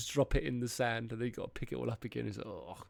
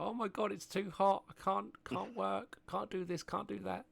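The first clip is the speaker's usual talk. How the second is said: high, hammed-up whiny complaint; mock crying; 8 semitones higher than usual. Imitating Brits sitting outside in the heat, mock crying. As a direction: whinge in a high pretend-tearful voice. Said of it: "we're all sitting outside crying".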